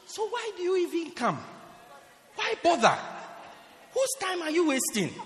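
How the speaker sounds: high, loud exasperation; exasperated questioning. Retold or imitated. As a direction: challenge him as a waste of time. Preacher acts out his exasperated thought.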